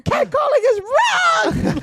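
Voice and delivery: high, slow, loud mock yell; yelling at family. Yelling at her family on the beach, a high slow yell. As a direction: belt it out like a protest slogan. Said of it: "I'm yelling at my family".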